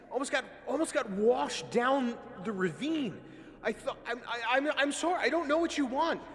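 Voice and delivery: high, loud frantic begging; pleading in terror. Acted. Carriage driver begs the furious leader, panicked pleading. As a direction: grovel desperately for your life. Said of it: "crying out in defense of themselves".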